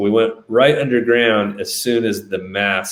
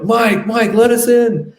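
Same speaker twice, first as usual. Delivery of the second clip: much higher, loud calling of a name; desperate calling out; 5 dB louder, slower than usual. Retold or imitated. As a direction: shout to a friend at the gate to let you in. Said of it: "we're like".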